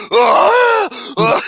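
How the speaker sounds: high, loud imitated moan; hammed-up wail of pleasure; mock orgasmic moans. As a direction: moan in exaggerated mockery. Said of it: "you can hear the orgasm".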